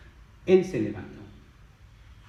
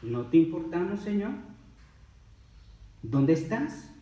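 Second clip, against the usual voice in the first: higher-pitched questioning of God; questioning plea; 5 semitones higher than usual. Acted. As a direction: ask the Lord where he is, voice lifting. Acts out people doubting God. (súplica en tono de pregunta)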